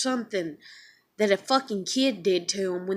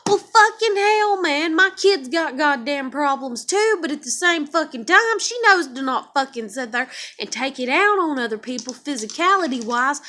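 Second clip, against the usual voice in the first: higher and louder furious outburst; real angry rant; 4 semitones higher, 6 dB louder than usual. Real, not acted. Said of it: "make me so fucking mad".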